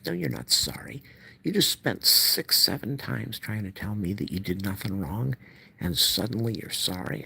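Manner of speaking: high-pitched, indignant retelling; retold indignation. Speaker retells rejecting mother's apology, indignant.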